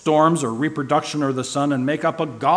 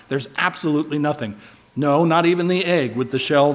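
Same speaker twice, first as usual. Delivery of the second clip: normal talking voice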